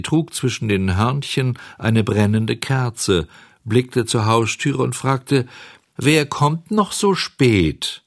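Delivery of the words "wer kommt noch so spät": unhurried, drawn-out higher-pitched question; slow snail. A narrator's reading. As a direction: take your time like a leisurely snail. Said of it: "So langsam und gemächlich wie zuvor".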